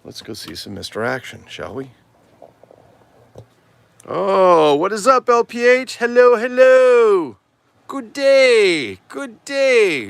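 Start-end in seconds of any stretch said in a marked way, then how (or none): 4.1-10.1 very high, loud falsetto hoot; hammed-up greeting voice. Vlogger greeting viewers as the tour begins, in hammed-up high voice. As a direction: sing out long, piercing, drawn-out hellos. Theatrical greeting on entering the back room.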